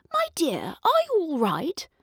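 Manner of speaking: a lightly voiced kindly fairy. A narrator's reading. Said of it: "she said".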